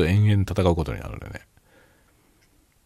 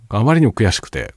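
fervent insistence; 6 semitones higher, 6 dB louder than usual. Real, not acted. Insists he sacrifices things to play.

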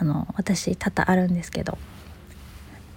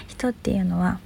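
ordinary talk, nothing marked

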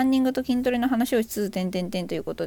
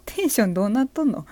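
usual speaking voice

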